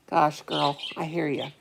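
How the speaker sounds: usual speaking voice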